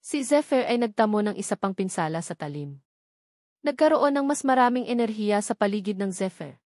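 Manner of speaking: usual speaking voice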